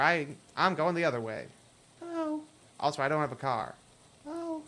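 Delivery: ordinary talk, nothing marked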